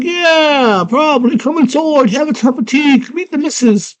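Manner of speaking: very high squeaky worm; tiny worm voice. Retold or imitated. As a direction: chirp it like a tiny creature. Worm invites her in.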